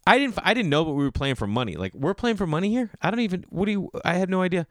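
high, pleading mock protest; flustered protest. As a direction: squeak out the excuse in disbelief. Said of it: "And I wanna be like".

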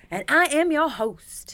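high, loud, drawn-out announcement; mock grand host. Host prompted to introduce self, mock grand announcement. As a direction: announce yourself with hammed-up grandeur.

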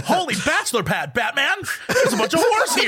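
giggling campy sidekick voice; laughing Robin impression. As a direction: laugh through the line. Mock Robin line, laughing.

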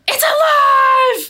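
high, loud, drawn-out cry; imitated horrified shout. Partner finds dead mouse moving, shocked shout. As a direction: mimic a shocked, melodramatic yell. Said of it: "all of a sudden Caleb goes".

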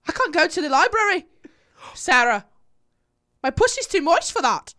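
high, put-on Irish accent; hammed-up Irish accent. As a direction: pitch it up in a mock Irish accent. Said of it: "an Irish accent".

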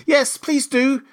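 high, eager group plea; joining in chorus. A narrator's reading. Others begging the mouse back, eager chorus. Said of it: "the others all joined in chorus".

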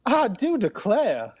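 high, slow Southern belle; showy put-on Southern drawl; Southern accent. Shown as a demonstration. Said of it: "What, a Southern".

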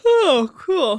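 mid-yawn. Real, not acted. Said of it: "Did you, uh, yawn during my".

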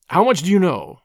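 quick burst of demanding question; shot-out question. A narrator's reading. Whittington interrogates Tuppence, shot out. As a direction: fire the question out, punching it. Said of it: "he shot out".

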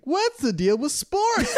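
high, drawn-out joke setup; mock stand-up comic. Man turning to girl afterwards, hammed-up comic voice. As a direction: stretch it out high like a corny comedian. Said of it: "You turn around, you're like".

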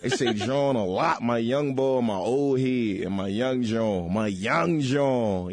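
slow, stretched Philly slang; hammed-up Philly local; Philly accent. Shown as a demonstration. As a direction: drag out the Philly vowels. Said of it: "Yeah, they do say John".

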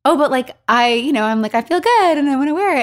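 high, wheedling mock protest; playful self-imitation, pitched well up; retold pleading. Own inner protest acted out.

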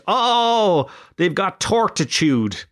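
high cooing "oh", then drawn out; mock how cute they all find it; gushing cutesy mockery. Mocking how people talk about torties, gushing cutesy voice. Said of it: "it's all cute".

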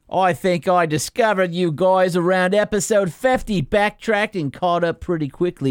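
slow, hammed-up Australian accent. Reading a listener email in a mock Australian accent. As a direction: read it like a bloke from Brisbane, drawn out. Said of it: "You hate when I do these kind of accents".

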